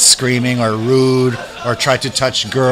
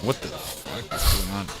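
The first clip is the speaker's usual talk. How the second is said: much softer, lower aside; hushed disbelief; 4 semitones lower, 11 dB quieter than usual. Retold or imitated. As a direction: imitate onlookers murmuring in disbelief. Onlooking family reacts to the scene.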